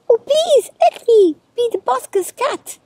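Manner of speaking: very high, loud pleading; high-pitched eager kitten plea; deafening young cat. A narrator's reading. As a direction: beg loudly in a squeaky high voice. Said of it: "he said in a deafening meow".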